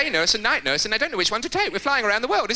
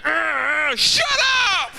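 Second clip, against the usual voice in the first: sudden loud yell of shut up; hammed-up shout through a wall; mock shout; 9 semitones higher, 4 dB louder, much slower than usual. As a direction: bark it at the people next door. Shouting at noisy toilet neighbours.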